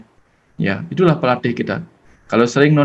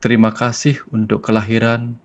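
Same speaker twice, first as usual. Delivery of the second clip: guided prayer; 2 semitones lower than usual. Acted. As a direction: lead the listeners gently into silent prayer. Leads a closing prayer. (doa yang tenang dan pelan)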